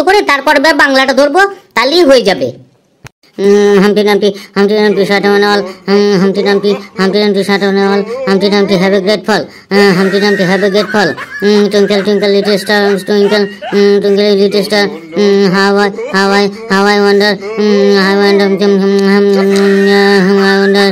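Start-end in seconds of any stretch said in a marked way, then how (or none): 3.4-11.1 drawled, halting nursery rhyme with hums; slow mumbled rote recital. Acted. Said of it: "ঠিকঠাক উচ্চারণ আসছে না". 11.4-20.9 slow halting recital. Acted. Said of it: "ঠিকঠাক উচ্চারণ আসছে না".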